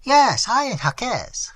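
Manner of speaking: high, loud, drawn-out interjection; flustered servant chiming in slowly; eager cry. A narrator's reading. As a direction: butt in over your comrade. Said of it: "cried his comrade".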